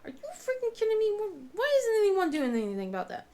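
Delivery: high, fast exasperated outburst; a mom who has had enough; outraged mom. Retold or imitated. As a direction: let the disbelief explode. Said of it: "I wanna be like".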